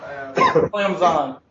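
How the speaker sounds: "Clemson" said as Clem-zun with a z and no p, mock refined; "Clemson" as Clem-zun, pinky up and mildly mocking; pinky-up pronunciation. Shown as a demonstration. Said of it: "hold your pinky up and say Clemson".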